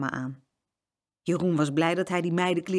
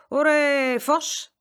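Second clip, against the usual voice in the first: loud, higher cheer to the horse; farmer calling out; 9 semitones higher, 4 dB louder, much slower than usual. Acted. Farmer urges his horse on, loud call. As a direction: call out to get the horse moving. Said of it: "zei de boer".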